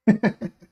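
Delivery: sharp high-pitched laugh; loud real laugh. Real, not acted. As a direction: laugh hard at it. Laughing at the retort.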